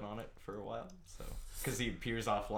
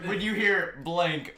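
mock bleeped line; 7 semitones higher, 9 dB louder, slower than usual. Shown as a demonstration. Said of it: "it's just gonna go".

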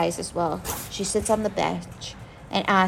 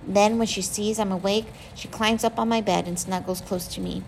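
usual speaking voice

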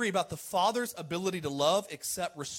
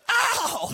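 high loud yelp; playful yelp; 7 dB louder than usual. Real, not acted. Preacher to crowd, a high playful yelp. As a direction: yelp it out like you got hit.

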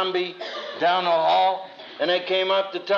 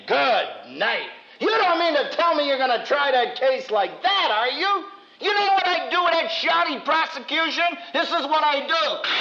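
storming professor; 8 semitones higher than usual. Retold or imitated. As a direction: storm down the aisle scolding students. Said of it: "come storming down ... saying".

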